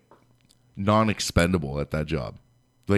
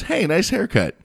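much higher, chirpy compliment; sudden fake cheer; 11 semitones higher than usual. Boss covering himself with a sunny compliment. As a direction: switch to a bright fake-friendly tone. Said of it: "he smiles instantly and goes".

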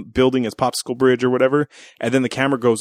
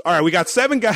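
Bruce Willis; 6 semitones higher than usual. Acted. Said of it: "Remar's like Bruce Willis".

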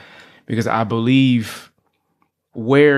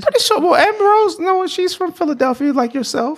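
high put-on voice; 13 semitones higher than usual. Pretend posh answer about Amber Rose.

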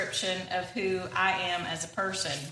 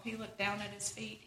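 ordinary talk, nothing marked

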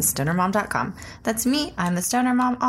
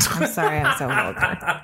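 ordinary talk

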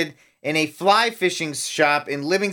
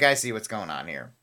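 mild knowing murmur; 10 semitones lower, 7 dB quieter than usual. Retold or imitated. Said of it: "He's like".

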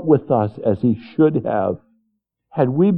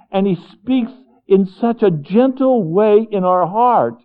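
preaching with rising force, a bit louder; fervent preaching; 6 semitones higher, 3 dB louder than usual. Acted. Preacher urging his congregation, fervent and louder. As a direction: press the congregation, building fervour.

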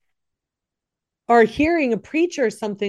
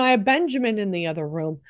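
usual speaking voice

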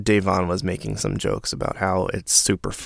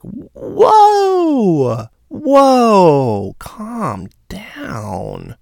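long, high, loud falling whoa; shocked whoa; 11 semitones higher, 4 dB louder, much slower than usual. Acted. Reacting to a harsh comment, exaggerated shock.

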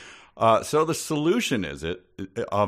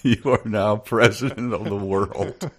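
mock grand, laughing; laughing proclamation; 3 semitones higher, 5 dB louder than usual. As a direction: announce it through laughter.

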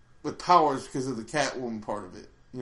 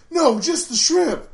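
lightly voiced cartoon Robin, much higher and slow; mock deadpan cartoon hero; Robin answers; 14 semitones higher, 8 dB louder, slower than usual. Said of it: "He goes".

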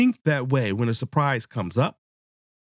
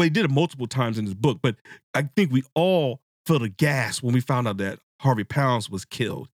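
ordinary talk, nothing marked

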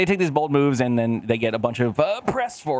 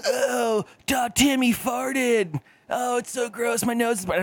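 mocking middle schoolers; 11 semitones higher, slower than usual. Middle schoolers groaning about a fart, high and whiny. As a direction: squeal it like a grossed-out middle schooler. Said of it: "A bunch of middle school people like".

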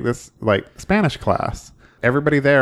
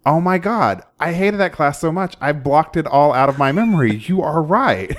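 excited; 6 semitones higher than usual. Retold or imitated. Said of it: "and said".

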